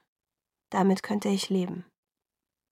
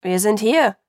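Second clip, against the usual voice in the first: louder call from another room; calling back; 6 dB louder than usual. A narrator's reading. Charlie answers from the living room, loud call. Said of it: "rief Charlie".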